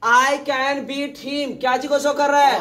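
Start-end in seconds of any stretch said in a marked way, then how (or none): 0.1-1.5 very slow and deliberate; slow model. Shown as a demonstration. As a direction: drag each word out for the class. (बहुत धीरे धीरे बोलो)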